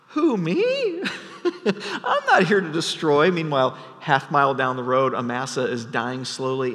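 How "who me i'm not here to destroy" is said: high, feigned surprise with a laugh; a general protesting too much; mock innocent Joab. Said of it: "And Joab says".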